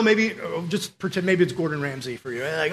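normal talking voice

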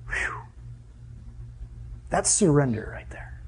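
soft, breathy whisper of awe; awed whisper. Real, not acted. Preacher hushed before his church, whispering in awe. Awed aside after the kneeling story.

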